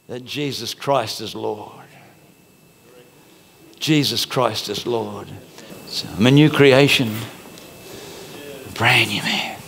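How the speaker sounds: hushed conviction. Real, not acted. Quiet altar-call prayer.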